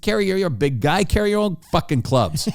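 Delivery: high, exasperated kiss-off; really frustrated. Retold or imitated. Caddy quitting on the big golfer, frustrated. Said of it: "this guy's getting really frustrated".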